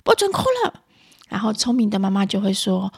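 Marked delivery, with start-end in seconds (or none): 0.0-0.8 high, loud scolding voice; irritable mother. Acted. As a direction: act out an exasperated mum cracking down on crying. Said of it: "暴躁的妈妈都会这样说". (暴躁妈妈高声喝斥)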